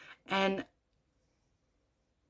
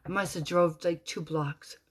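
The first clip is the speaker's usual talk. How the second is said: ordinary talk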